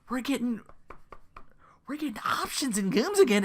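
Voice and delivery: whispered with a touch of glee; excited whisper. Real, not acted. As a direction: breathe it out like a secret treat.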